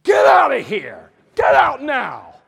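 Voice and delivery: slow, roaring high shout; furious shout. Retold or imitated. Sergeant screaming at the soldier to get out. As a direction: throw him out at full volume. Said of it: "he went crazy".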